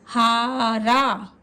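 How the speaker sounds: हा रा very slowly, both आ held long; both vowels stretched. Shown as a demonstration. Teacher sounding out both long vowels. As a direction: draw out each आ. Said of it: "उसको थोड़ा देर तक बोलेंगे".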